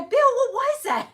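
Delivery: high, loud panicked question; alarmed cry. Retold or imitated. Wife asking husband about the crash, panicked. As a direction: shout it in sudden fright. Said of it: "I go".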